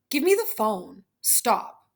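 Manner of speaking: ordinary delivery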